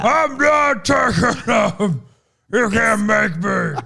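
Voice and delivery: high, slow, mock roid-rage shout; hammed-up furious refusal; mock roid rage, refusing his pills. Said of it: "very roid ragey".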